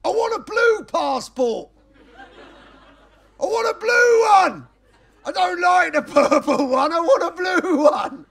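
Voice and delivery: high, loud bawling brat demanding a blue passport; whiny child tantrum. Retold or imitated. Mocking Brexit voter as a bawling child. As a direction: stamp your feet like a crying toddler.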